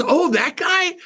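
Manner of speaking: very high mock realization; sudden recognition. Coworker finally remembers the car detailer, mock high-pitched recognition. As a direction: imitate a coworker finally catching on. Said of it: "She muffles the phone, but I could still hear".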